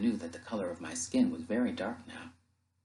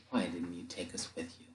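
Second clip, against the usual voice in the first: low, soft and despairing; hushed, low, defeated murmur; dejected question; 4 semitones lower, 8 dB quieter than usual. Acted. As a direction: ask it quietly, all hope gone. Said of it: "I said".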